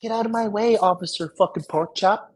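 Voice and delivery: high, angry yelling at the cop; cussing out a police officer, high angry yell; furious rant. Retold or imitated. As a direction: shout it down at the officer, raging.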